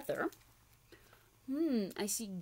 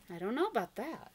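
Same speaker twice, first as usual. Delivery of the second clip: plain reading voice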